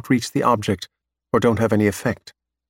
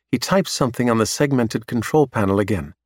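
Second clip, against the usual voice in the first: ordinary delivery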